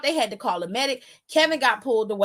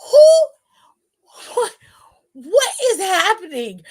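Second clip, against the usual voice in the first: much higher, loud, laughing in disbelief; shocked laughing outburst; 6 semitones higher, 8 dB louder, much slower than usual. Retold or imitated. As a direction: blurt it out through a laugh of shock. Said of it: "I was like".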